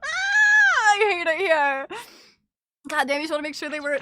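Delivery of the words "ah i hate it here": high, loud laughing wail; real embarrassed laugh; laugh-crying. Real, not acted. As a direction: groan through laughter. Laughing at own blunder.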